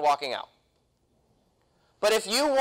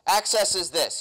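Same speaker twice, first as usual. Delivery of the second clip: usual speaking voice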